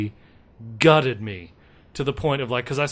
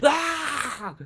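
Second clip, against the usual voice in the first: high mock scream of fright; a hammed-up startled cry; 12 semitones higher than usual. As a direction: yelp like the bat just grabbed you. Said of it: "the bat grabbing me and going".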